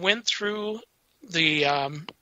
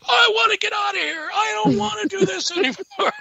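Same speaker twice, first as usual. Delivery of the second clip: high, loud frightened shout; scared panic; 4 dB louder than usual. Retold or imitated. Partner pulled forward by a ghost, high panicked shout. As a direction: yelp it like you want out now. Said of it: "and he's like".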